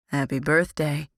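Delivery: a hint of a deep male voice; lightly voiced rumbling young man; deep rumble. A narrator's reading. Lucas greeting her from behind, a light deep rumble. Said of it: "Lucas's deep voice rumbled".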